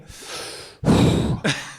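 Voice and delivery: long, airy exhale; resigned sigh. Retold or imitated. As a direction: blow out a heavy acted sigh. Acted resigned driver sighing.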